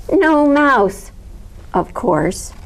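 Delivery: ordinary delivery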